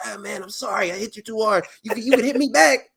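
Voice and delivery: put-on high, fast, pleading kid voice; a kid begging his brother to hit back; high sheepish apology, rushing; kid apologizing. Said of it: "shit that you say to your brother as a kid".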